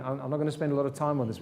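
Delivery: ordinary talk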